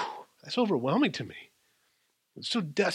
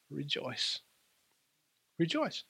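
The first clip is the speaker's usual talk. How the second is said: hushed rejoice; 9 dB quieter, slower than usual. Acted. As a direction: bring rejoice down to a murmur.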